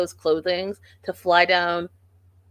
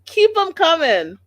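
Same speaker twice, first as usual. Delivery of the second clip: high, loud cheer for more; egging on a speaker for more; cheering shout; 9 semitones higher, 8 dB louder than usual. Retold or imitated. Cheering the mother on, a loud high shout. Repeated, louder cheering take.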